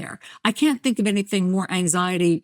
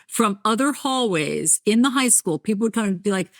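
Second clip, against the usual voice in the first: usual speaking voice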